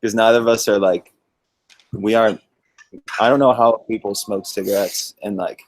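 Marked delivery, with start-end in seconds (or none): none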